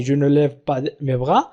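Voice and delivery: "Je ne lève pas mes bras" in one run, low and soft; whole sentence connected. Shown as a demonstration. Teacher repeating the negative sentence for learners, connected. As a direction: join "Je ne lève pas mes bras" up without breaks, rising at the end.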